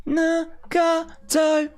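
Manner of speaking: very high, slow sing-song no one cares; mock falsetto. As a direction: drag it out high and mocking. Mocking sing-song dismissal.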